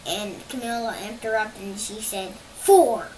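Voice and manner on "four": blurted. Retold or imitated. Camilla blurts answer.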